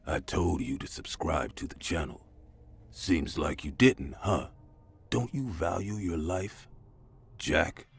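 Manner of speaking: low, slow menacing villain; creepy coarse voice. Retold or imitated. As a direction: threaten him slowly and softly. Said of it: "a man with a creepy, coarse voice".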